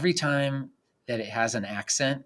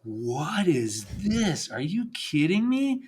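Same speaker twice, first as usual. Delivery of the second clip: amazed friends; 6 semitones higher, slower than usual. Retold or imitated. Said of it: "And they're like".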